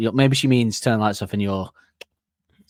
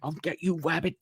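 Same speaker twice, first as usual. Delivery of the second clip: higher, softer cartoon hunter; Elmer Fudd threat; 6 semitones higher, 7 dB quieter than usual. Elmer Fudd vowing revenge on the rabbit, higher cartoon voice. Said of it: "he goes".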